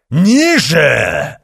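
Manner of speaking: loud, high bark; barked command. A narrator's reading. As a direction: snap the order at them. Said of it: "рявкнул оборотень Альфа".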